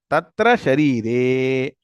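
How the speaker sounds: Tatra Sharire recited slowly, each syllable clear; slow recitation. Shown as a demonstration. Teacher drilling students, reciting Tatra Sharire slowly. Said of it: "Slowly now".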